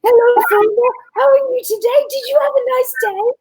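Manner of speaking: high-pitched doggy talk from a mum greeting her pet; sing-song baby talk. Retold or imitated. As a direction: coo it like talking to a puppy. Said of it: "mum was like".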